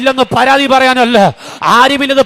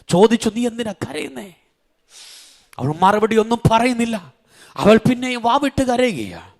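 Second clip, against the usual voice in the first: hushed, much softer retelling of the weeping widow; soft sermon storytelling; 5 semitones lower, 8 dB quieter than usual. Real, not acted. Preacher softly retells the widow's story to the church. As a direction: tell it quietly, drawing them close.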